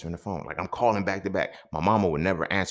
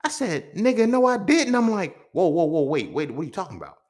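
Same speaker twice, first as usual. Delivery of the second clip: much higher and louder, frantic; panicked denial; 13 semitones higher, 8 dB louder than usual. Retold or imitated. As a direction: shout it out in panic.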